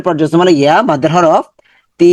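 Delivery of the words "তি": তি drawn out slowly; long তি. Shown as a demonstration. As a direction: stretch the vowel of তি. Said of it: "তিটা লম্বা হবে".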